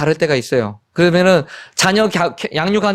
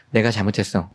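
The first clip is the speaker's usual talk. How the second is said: low, soft admission of fault; sincere apology; 8 semitones lower, 5 dB quieter than usual. Retold or imitated. Father admitting fault to son, quiet and sincere. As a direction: own up quietly, voice dropped right down.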